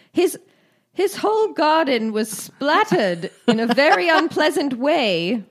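high, swooping mock British accent; hammed-up British accent, breaking into a laugh. Co-host quotes shocked Windsor councillor, hammed-up British accent. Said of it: "decided to do an accent".

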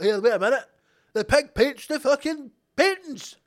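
high-pitched mock astonishment; hammed-up amazement, pitched right up; amazed discovery. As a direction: announce it like an incredible revelation. Acted amazed discovery of painting pig.

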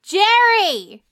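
high, drawn-out shout; loud, insistent hollering; demanding yell. Acted. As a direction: bellow a spoiled demand to the kitchen. Demanding dessert from the cook.

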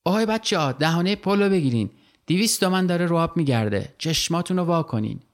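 high yell across the river; shouting. Acted. Nasro yelling to the kids at the bridge mouths, shout. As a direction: call out to the kids to block the bridge. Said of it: "نصرو فریاد کشید".

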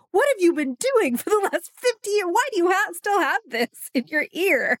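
high, disbelieving quote; high mock disbelief at the patient; incredulous question. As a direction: ask it in sheer disbelief. Host imagines asking the patient.

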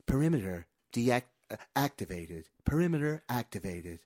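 imitated system voice, measured phrases; automated woman's announcement. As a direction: mimic a looping security-system announcement. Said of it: "there's a woman's voice on this sonic wall".

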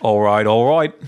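McConaughey catchphrase with a mock English lilt; British Matthew McConaughey. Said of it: "British Matthew McConaughey".

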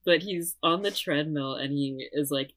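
normal talking voice

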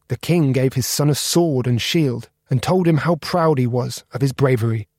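usual speaking voice

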